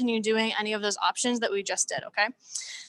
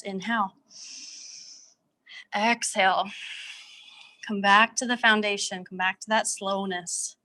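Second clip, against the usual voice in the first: guided breathing. Acted. Leads closing breaths.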